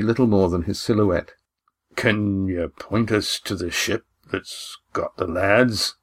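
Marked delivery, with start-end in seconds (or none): 2.0-6.0 slow, hoarse whispered question. Acted. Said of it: "His hoarse whisper surprised her".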